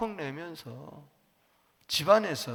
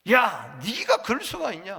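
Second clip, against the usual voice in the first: acted indignant outburst, louder and higher; angry outburst; 5 semitones higher, 6 dB louder than usual. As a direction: act out a furious disciple confronting the others. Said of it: "화를 벌컥벌컥 내는 거예요. 씩씩거리면서".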